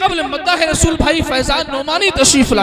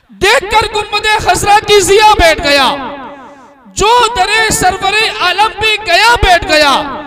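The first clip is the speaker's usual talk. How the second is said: raised, ringing poetry recitation to a crowd; recited verse; 7 semitones higher, 5 dB louder, slower than usual. Acted. Announcer reciting the sher to the crowd, raised and ringing. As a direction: declaim the couplet for the gathering.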